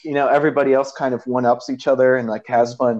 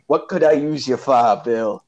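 lightly mocked Boston accent; Jack Nicholson's Boston accent. Film fan imitating an actor's slipping accent, mock Boston. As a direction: put on a hint of broad Boston. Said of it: "Now you're from Boston?".

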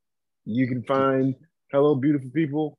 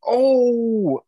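high loud cry; 13 semitones higher, 7 dB louder than usual. Real, not acted.

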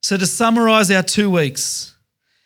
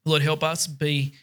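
quiet prayer; 4 dB quieter than usual. Acted. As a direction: pray it gently to close the service. Closing prayer to God.

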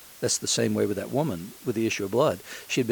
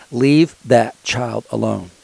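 louder, slow and firm command; commanding voice of God; 9 dB louder, slower than usual. Retold or imitated. Pastor voicing God guarding his sick son, slow and loud. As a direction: proclaim it like a booming divine order. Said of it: "is what God said".

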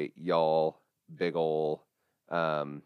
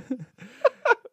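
high laugh; 5 dB louder than usual. Real, not acted. Cut that out immediately.

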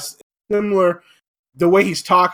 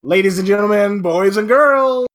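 loud announcer voice, big and bright; booming kids show opener; 2 semitones higher, 5 dB louder than usual. Acted. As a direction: open the show like a ringmaster.

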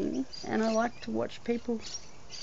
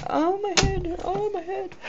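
mock moaning; 11 semitones higher than usual. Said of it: "I'm walking around going".

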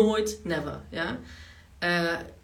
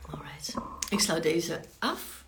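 whispering; slower than usual. Real, not acted. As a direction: whisper it to yourself. Closing the stream, quietly aside.

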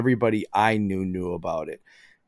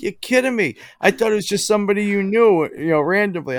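surprised wife; 10 semitones higher, 4 dB louder, faster than usual. Retold or imitated. Wife learns she missed it.

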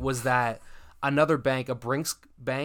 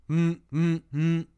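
closed-mouth buzzing, raised in pitch; pager buzz imitation; 4 semitones higher, slower than usual. Storyteller imitating the pager going off, buzzing hum. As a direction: hum short pager buzzes.

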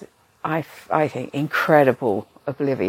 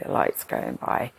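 the lights going by, dropped much lower and rising at the end; much lower, dreamy repeat of the lights going by; dreamy low echo; 5 dB quieter than usual. Retold or imitated. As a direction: sink the voice low and let it drift upward. Lying on gurney watching lights pass.

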